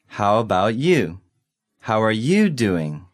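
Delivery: slow, deliberate questions; slowly. Shown as a demonstration. Returning the question in a practice dialogue, slowly. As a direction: space the words out for learners. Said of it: "one more time slowly".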